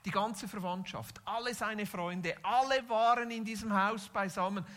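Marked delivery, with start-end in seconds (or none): none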